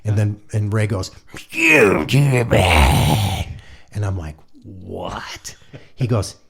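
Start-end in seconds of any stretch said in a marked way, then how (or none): 1.5-3.5 loud, very drawn-out garbled growl; imitating a gruff, unintelligible musician; grumbling Ray. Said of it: "grumbling in the background". 4.6-5.6 soft, whispered "what?"; retold stunned whisper; hushed disbelief. Baffled reply to garbled words.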